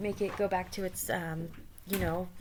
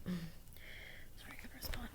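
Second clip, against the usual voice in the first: whisper; 13 dB quieter, slower than usual. Real, not acted.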